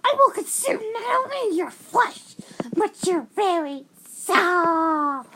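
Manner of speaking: high, menacing flower voice with a slow, drawn-out finish; a creepy cartoon flower threatening you; Flowey voice. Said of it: "I'm doing my best Flowey voice".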